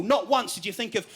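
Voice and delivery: usual speaking voice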